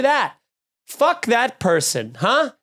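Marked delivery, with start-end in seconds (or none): none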